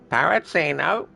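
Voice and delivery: high squawky parrot. Retold or imitated. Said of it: "Doing an impression of a parrot".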